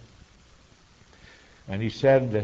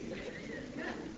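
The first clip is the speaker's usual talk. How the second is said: breathy laugh; 18 dB quieter than usual. Retold or imitated. Wife's delighted laugh.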